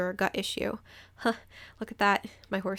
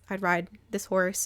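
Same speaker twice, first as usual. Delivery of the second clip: usual speaking voice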